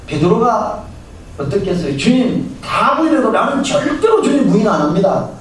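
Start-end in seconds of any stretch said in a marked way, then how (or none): 2.0-5.3 much higher, fervent pledge; Peter's earnest vow. Retold or imitated. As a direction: swear it with all your heart. Peter's boastful vow to Jesus. (훨씬 높고 열렬한 맹세)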